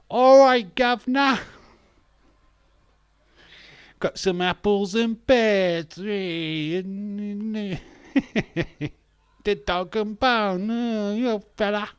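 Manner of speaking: loud, high, drawn-out mock Cockney; over-the-top music-hall Cockney; hammed-up Cockney. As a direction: belt out a cartoon Cockney accent. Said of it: "make her sound like she's Cockney".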